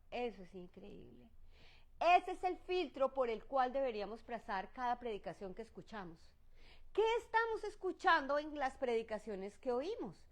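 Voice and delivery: usual speaking voice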